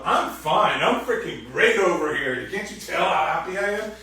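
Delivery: sarcastic lie. Retold or imitated. Upset person lying to partner, loud sarcastic fine. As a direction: lie through your teeth sarcastically. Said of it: "or you're gonna lie".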